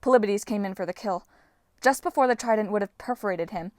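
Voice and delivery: ordinary delivery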